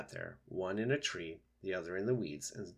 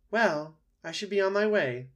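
high, louder, brisk opening; opossum taking leave; 10 semitones higher, 8 dB louder than usual. Acted. Opossum leaving after awkward silence, high and sociable. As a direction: break an awkward silence brightly.

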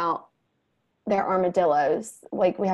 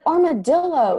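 firm correction; 9 semitones higher, 6 dB louder than usual. Retold or imitated. Mom correcting the word.

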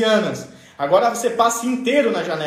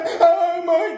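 mock crying; 8 semitones higher than usual. Child coming from afar before being hit, mock crying. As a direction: wail for mum in pretend tears. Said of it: "já vinha chorando".

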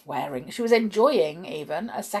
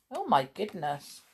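ordinary delivery

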